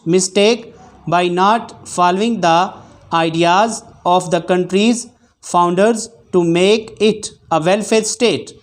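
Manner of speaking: measured dictation read with spoken punctuation. Shown as a demonstration. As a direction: read each phrase evenly for shorthand students. Reads timed dictation aloud. (Dictation पढ़ने का अंदाज़)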